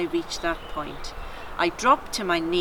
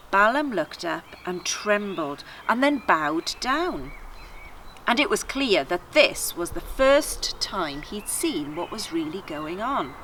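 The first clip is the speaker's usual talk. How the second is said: ordinary delivery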